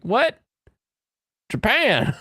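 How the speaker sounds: high-pitched incredulous question and reply; mock bafflement. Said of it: "and we're like".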